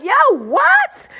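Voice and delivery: squeaky, disbelieving yo what; high shocked what. Retold or imitated. Young [name] rejecting her friends' plan, in high disbelief. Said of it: "I would be like".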